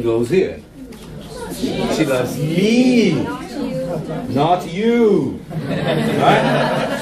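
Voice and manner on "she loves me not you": slow it down and punch the word me; stress on ME. Shown as a demonstration. Teacher to class, stressing me against you. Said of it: "stress the word".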